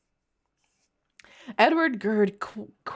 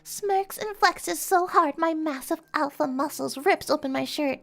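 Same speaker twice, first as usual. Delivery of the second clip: higher, lightly put-on imitation of a friend; Willow voice; 7 semitones higher, 3 dB quieter than usual. Reading the fanfic in friend Willow's voice, lightly put-on. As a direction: read it as Willow would, a light mimic. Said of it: "let me read this in a Willow voice".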